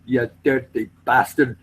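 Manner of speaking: imitated Scotsman telling someone off; put-on Scottish insults; mock Scottish scolding. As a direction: play an offended Scot, breaking into laughter. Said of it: "And then he goes".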